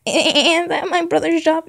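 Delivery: loud, high-pitched objection; indignant protest. Acted. Line reread as whining kid.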